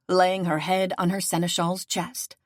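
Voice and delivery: ordinary delivery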